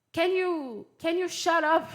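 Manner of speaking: blunt, high and loud rude request; rude demand. Acted. Rude request example, to be softened.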